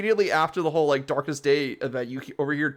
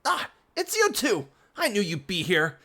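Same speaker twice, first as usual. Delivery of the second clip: high, pompous villain; haughty villain voice; 5 semitones higher than usual. Acted. Villain brother mocks the champion, high pompous voice. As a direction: sniff down at the kids, high and haughty.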